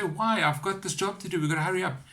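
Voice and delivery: high, quick-fire retold objection; hurried protest.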